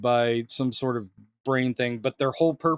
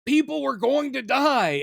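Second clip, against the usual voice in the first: higher, slightly louder mock anguish; imitating Superman's plea; 10 semitones higher, 3 dB louder than usual. Host quotes Superman's trailer line, higher mock anguish. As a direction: echo his desperate trailer line. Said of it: "where you hear him do".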